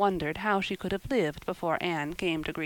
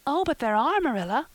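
put-on high, louder, eager girl; eager cry; 8 semitones higher, 6 dB louder than usual. A narrator's reading. Anne contradicting Marilla about ghosts, eager. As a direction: burst out insisting, pitched high. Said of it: "cried Anne eagerly".

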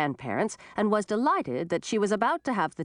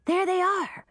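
high excited fairy shout; 12 semitones higher than usual. A narrator's reading. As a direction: call out high to the sky. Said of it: "shouted one of the fairies".

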